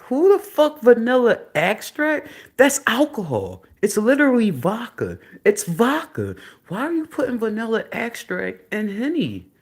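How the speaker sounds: high, loud, outraged disbelief; incredulous rant. Real, not acted. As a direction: rant at the absurd recipe at full pitch. Rants about the drink recipe.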